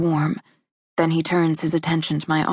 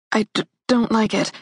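shivering stammer; stuttering from cold; 4 semitones higher than usual. A narrator's reading. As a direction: stammer through chattering teeth. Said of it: "My teeth are chattering".

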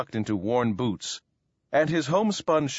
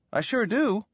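put-on high-pitched boyish surprise; astonished exclamation; 10 semitones higher than usual. A narrator's reading. Boy asked if he likes corn pone, astonished. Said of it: "Abe exclaimed, astonished at the question".

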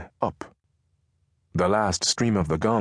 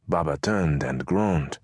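ordinary delivery, nothing marked

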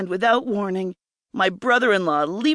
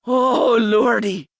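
louder, raised outcry; crying out; 4 semitones higher, 5 dB louder, slower than usual. A narrator's reading. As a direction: cry out in rapture. Heroine cries out in pleasure.

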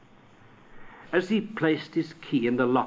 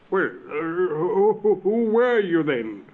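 higher, louder, halting question; raising his voice; 7 semitones higher, 5 dB louder, slower than usual. Acted. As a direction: push the voice up with nervous hesitation. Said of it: "raising his voice".

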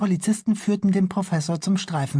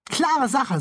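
put-on boy Bülle; 10 semitones higher than usual. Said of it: "sagte Bülle".

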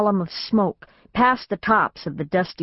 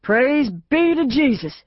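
slow, high praising call; yelling praise; 7 semitones higher, 3 dB louder, slower than usual. A narrator's reading. Father jumping from the burning car, slow high praising call. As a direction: call out thanks to Jesus, drawn out.

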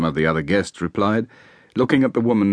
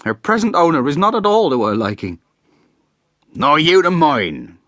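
bitter retort; 9 semitones higher, 8 dB louder than usual. Acted. Husband and wife trading barbs.

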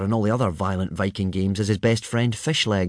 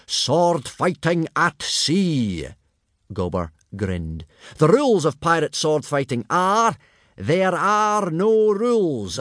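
booming Viking instructor; 8 semitones higher than usual. A narrator's reading. Gobber relishes explaining the brutal rules to the boys, higher and booming.

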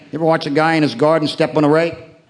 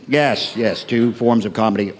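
usual speaking voice

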